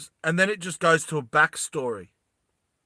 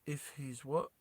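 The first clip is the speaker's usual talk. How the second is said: ordinary delivery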